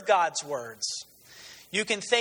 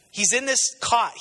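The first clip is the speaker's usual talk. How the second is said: ordinary delivery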